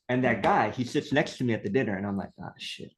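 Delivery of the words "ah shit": very soft, voiceless mutter; retold sinking-heart whisper; dismayed aside. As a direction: curse under your breath in dread. Dreading dinner beside difficult man.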